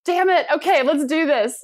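high, exasperated give-in; grudging surrender. Retold or imitated. [name] gives in to her coaching group, high and exasperated. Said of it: "And I was like".

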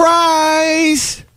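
put-on very high, drawn-out child's cry; excited little daughter. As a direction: imitate a small kid springing a surprise. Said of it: "Ellie goes".

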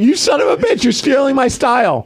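high-pitched outburst as an offended dog; hammed-up accusing protest; mock indignation.

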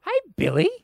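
high, loud, chirpy hello; sunny, friendly. Acted. Friendly bank teller greeting an old schoolmate, sunny. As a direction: greet with an exaggerated bright lilt upward. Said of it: "she was a really sunny, friendly person".

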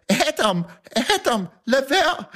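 mock shout of an editor breaking up a fight; imitated shout. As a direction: call out to stop him, climbing in pitch. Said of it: "så råbte Herbert".

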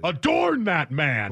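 slow, loud regal proclamation; commanding king. Shown as a demonstration. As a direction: chant it like a king in Game of Thrones. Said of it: "But, like, with power, like you're a king".

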